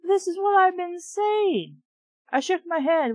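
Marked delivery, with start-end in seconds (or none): 0.0-1.8 high, triumphant I-told-you-so; smug vindication; vindicated exclamation. Real, not acted. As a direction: point out being right. Reader vindicated by character.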